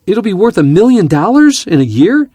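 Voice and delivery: high and loud thanks, settling down at the end; hammed-up delight fading to polite thanks; imitating gushing caller. Pilot mimics instructor thanking his son, loud and gushing. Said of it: "you could hear him saying".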